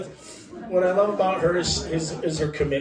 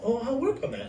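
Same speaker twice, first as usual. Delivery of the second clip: light wife voice; 9 semitones higher, 5 dB quieter, faster than usual. Retold or imitated. Quoting wife's easy promise.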